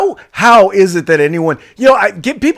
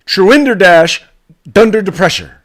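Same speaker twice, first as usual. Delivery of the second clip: loud, higher stab at Truender dash dunder depressure; mock attempt; 6 semitones higher, 7 dB louder than usual. Co-host loudly trying the Bidenese word himself. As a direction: blurt the new word out. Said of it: "co-host tries the word".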